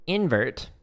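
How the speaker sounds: ordinary talk, nothing marked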